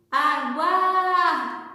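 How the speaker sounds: stretched, sung-out call of the word. Shown as a demonstration. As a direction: call it to someone far ahead, drawing out the stressed syllable. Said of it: "você vai gritar, chamar aquela palavra". (chamando de longe, sílaba alongada)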